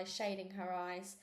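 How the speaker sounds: plain reading voice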